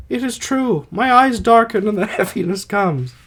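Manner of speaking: high put-on dying voice with a laugh slipping in; Alcestis voice. Dying wife telling her husband her eyes darken, high voice cracking into laughter. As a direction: hold the high wife voice while starting to laugh. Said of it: "I'll try to do voices".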